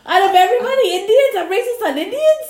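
high, loud indignant protest; mock indignation. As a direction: act offended and loud. Indignant denial of racism.

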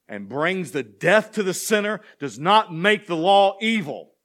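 fervent sermon cadence, climbing in pitch; full-force preaching. Acted. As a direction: preach the psalm out to the back row. Pressing the point on sin.

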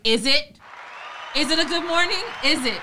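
high, pointed sarcastic questioning; mock indignant challenge. Voicing grumpy reply to greeting.

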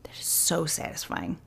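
breathy whisper of relief; whispered delight. Real, not acted. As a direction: whisper it like a treat. Savoring the withdrawal.

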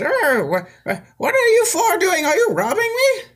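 put-on very high, quavering old wizard; startled old wizard. Wizard catches the party in his basement, very high alarmed old man. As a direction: squeak like a frightened old man. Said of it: "he says".

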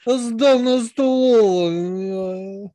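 bad fake Russian accent, slow; hammy put-on Russian accent. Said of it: "Just tried to fucking fake a Russian accent".

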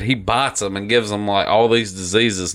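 normal talking voice

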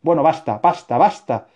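loud, furious cry of basta; angry outburst. A narrator's reading. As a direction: slam the door on the argument, shouting. Author loses patience with character.

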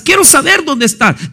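shouted preaching; God demanding an answer, shouted. Acted. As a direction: cry it out to the congregation. Preacher shouts God's question.

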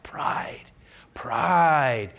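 slow, hushed whisper. Acted. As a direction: lean in and whisper the word pride. Preacher stresses Paul's pride.

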